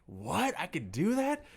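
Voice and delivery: high, disbelieving mock surprise; retold surprise. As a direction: lift the voice up as if you can't believe it. Teen self stunned by teacher.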